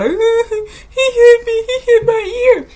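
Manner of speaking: high, drawn-out mock child whine; whiny kid. Kid telling on another boy in a game, mock whiny. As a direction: imitate a little boy wailing in complaint. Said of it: "And so then they got sad".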